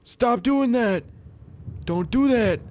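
hoge, luide paniekroep; panicked yelling. Real, not acted. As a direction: schreeuw het uit in paniek, veel hoger. Losing control of flying dragon.

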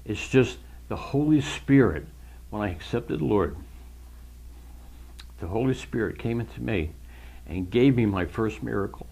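real choked-up testimony, voice catching into whispers; tearful blubbering. Real, not acted. Believer recalling first understanding God's word, overcome and tearful. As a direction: fight back tears, with long halting pauses. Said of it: "I'm sorry I'm blubbering".

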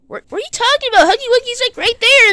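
high, excitable protest; incredulous reply. Pretend. Answering that the monster is right there, incredulous reply.

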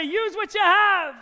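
loud, slow chant lead for the crowd; rallying call. Real, not acted. Performer leading the audience in a loud slow chant. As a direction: drive the phrase home to the hall.

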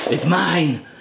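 slow, lightly voiced Gollum. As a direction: hiss it like Gollum. Gollum quote over salad.

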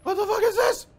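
high, loud panicked shriek; frightened shriek. Real, not acted. Player spotting something horrifying, shrieking in fear. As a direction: scream the question in real terror. Scared repeated question.

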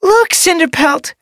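very high, slow, whiny wail; wailing kitten apprentice. A narrator's reading. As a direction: wail for help like a hurt kit.

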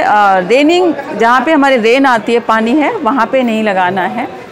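usual speaking voice

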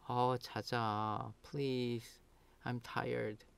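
weary, very soft plea; pleading. Shown as a demonstration. Tired sleeper to noisy people around, pleading. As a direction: beg quietly, worn out. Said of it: "So now you are pleading".

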